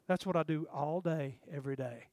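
low, quiet, careless sun; voicing the sun. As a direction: play the sun shrugging it off. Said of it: "The sun would go".

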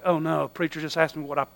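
the caught listener thinking in dread; mock sheepish panic, hushed; panicked inner thought. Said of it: "they're like looking at me like".

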